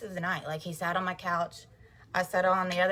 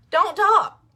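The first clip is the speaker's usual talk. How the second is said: much higher, much louder commands; urgent raised-voice advice to viewers; emphatic command; 10 semitones higher, 10 dB louder than usual. Real, not acted. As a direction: order them to stop and leave. Urging viewers to stop arguing.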